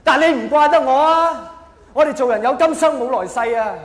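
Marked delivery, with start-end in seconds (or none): none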